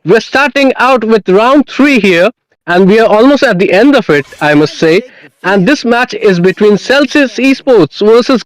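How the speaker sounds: high, hyped opening to the show; announcer voice. Acted. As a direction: welcome the crowd like a big event host. Caster opening the broadcast.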